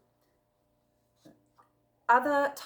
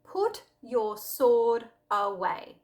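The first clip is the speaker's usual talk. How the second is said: slow, firm, louder command; a calm but firm command; 3 semitones higher, 6 dB louder, much slower than usual. Acted. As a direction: give it as an order, unhurried. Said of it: "Jesus commanded Peter".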